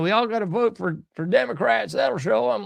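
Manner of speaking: a touch of a self-satisfied pundit; mocking Fuentes. Host parodying Fuentes telling fans to vote Democrat, mild mock voice. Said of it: "Fuentes is saying".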